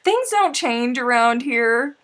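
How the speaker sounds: loud, slow teasing to the returning friend; loud slow tease. Real, not acted. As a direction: proclaim it grandly. Teasing welcome.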